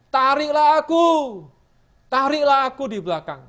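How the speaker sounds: seruan keras memohon tarik aku; teriakan memohon. Acted. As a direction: berseru lebih tinggi dan keras, memohon. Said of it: "dia teriak". (seruan keras penuh kerinduan)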